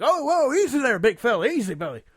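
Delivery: high, loud startled coaxing, like calming a horse; startled soothing. Retold or imitated. Actor startled by moving casket, soothing it loudly. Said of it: "He's like".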